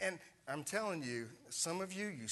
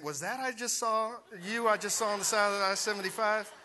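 louder, quicker incredulous question; surprised text; 5 semitones higher, 6 dB louder than usual. Retold or imitated. Pastor Neil texting him, lightly voiced surprise. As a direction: read the text as a startled colleague. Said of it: "Pastor Neil texting me saying".

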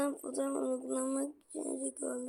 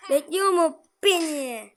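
loud slow call; 3 semitones higher, 8 dB louder, slower than usual. Real, not acted. Gamer shouts at waiting enemy.

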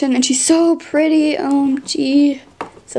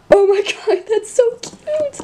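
much higher, slow, half-crying gush; overjoyed squeal; 9 semitones higher than usual. Real, not acted. Unboxing her first octopus pet, overjoyed squeal with a laugh. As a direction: squeal and laugh over something adorable.

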